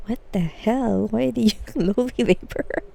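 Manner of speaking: normal talking voice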